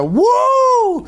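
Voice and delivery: very high shouted "woo"; crazy loon call. Shown as a demonstration. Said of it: "It's more like a".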